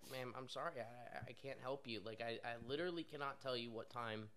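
softly retold customer-service apology; subdued apology. Pizza worker telling upset customer he can't help, subdued.

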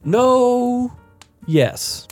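usual speaking voice

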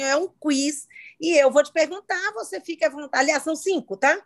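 ordinary talk, nothing marked

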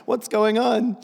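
hammed-up fidgety listener; impatient congregation. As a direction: play the restless crowd wondering when he'll stop. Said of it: "You're all like".

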